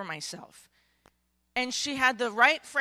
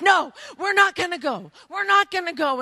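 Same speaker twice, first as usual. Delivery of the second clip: loud, high protest; pleading refusal; 6 semitones higher, 9 dB louder than usual. Acted. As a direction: refuse with desperate insistence. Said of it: "And they're like".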